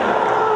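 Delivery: high, loud charging scream; retold battle cry at full volume; all-out running yell. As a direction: yell wildly as you charge the finish. Retold battle yell nearing finish line.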